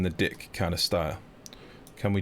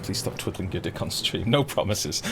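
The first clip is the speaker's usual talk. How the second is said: ordinary talk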